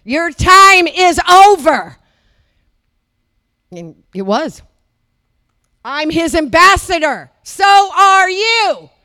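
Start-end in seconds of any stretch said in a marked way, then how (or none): 0.0-2.0 slow, building to a loud command; commanding rebuke. Retold or imitated. Preacher rebuking the temple, slow building command. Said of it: "I said". 7.4-8.9 shouted call. Acted. Preacher calling on congregation.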